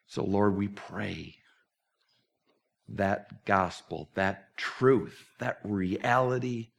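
reverent, slowed closing prayer. Acted. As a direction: pray it gently to close the service.

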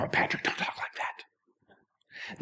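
slow whispered mock protest; slow, hushed imitation of a fretting listener; whispered objection. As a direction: whisper it like a worried churchgoer. Imagined listener scolding pastor.

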